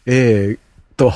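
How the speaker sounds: ordinary talk, nothing marked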